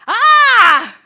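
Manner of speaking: loud, high-pitched open scream; sudden piercing yell; re-enacted scream. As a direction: let out one long "ah" scream at full volume. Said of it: "I was screaming".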